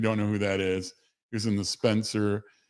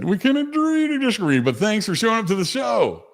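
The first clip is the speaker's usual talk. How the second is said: high, bright hype voice; excited shout-out; 13 semitones higher, 4 dB louder than usual. Real, not acted. Streamer greets Deb in chat, high and excited. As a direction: cheer the viewer in.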